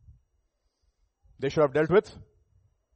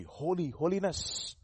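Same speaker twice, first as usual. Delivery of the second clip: slow, deliberate weight on Holy, holiness; stress on HOLY; 5 dB quieter, much slower than usual. Shown as a demonstration. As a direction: dwell softly on Holy, then holiness. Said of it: "emphasize on the word holy".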